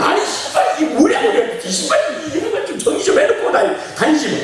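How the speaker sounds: high, loud scolding of a wife; mock exasperated nagging. Acting out wife's scolding about shoes.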